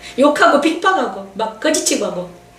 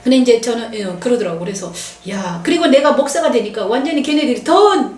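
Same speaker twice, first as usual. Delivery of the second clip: ordinary talk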